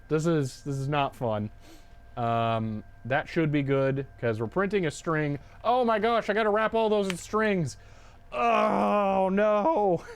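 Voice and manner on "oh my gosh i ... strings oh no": dismayed exclamation. Real, not acted. Realizes strings must be wrapped.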